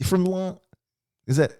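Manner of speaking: high mock New York accent fumbling; hammed-up New York accent; bad New York accent. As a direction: fake New York accent, losing the line. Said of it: "it's my New York accent".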